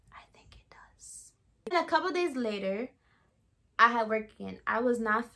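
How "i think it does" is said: barely audible slow whisper; hushed, drawn-out whisper; whispered aside. Real, not acted. Aside answering own question.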